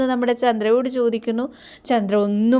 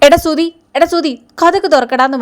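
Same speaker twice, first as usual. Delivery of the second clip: louder and higher, calling through the door; 5 semitones higher, 4 dB louder than usual. Retold or imitated. Brother banging on Sudhi's door, calling loudly. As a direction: call him out of the room. കതകിനിട്ട് തട്ടുകയാണ്.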